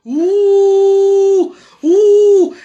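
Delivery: loud drawn-out booing ouh; mock booing. Leading the girls in booing irresponsible men, loud ouh. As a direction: boo them like a jeering crowd.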